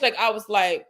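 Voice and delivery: ordinary talk, nothing marked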